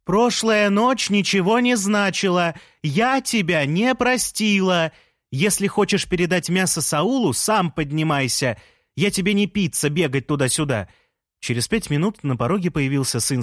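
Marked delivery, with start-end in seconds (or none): 0.0-10.9 raised pitch, words stretched to carry; shouting outside. A narrator's reading. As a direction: call it firmly out of the window. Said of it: "крикнула она на улицу".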